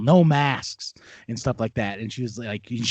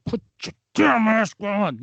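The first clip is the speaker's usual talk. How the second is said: higher, strained mock plea; mock exasperation; 6 semitones higher than usual. Manager fed up with maskless customer, mock exasperated. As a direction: push it out as if barely holding back.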